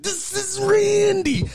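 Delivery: high, slow drawn-out Macho growl; Macho Man voice. Retold or imitated. Macho Man answering the prank call, drawn out. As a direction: drag it out like Randy Savage. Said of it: "He was like".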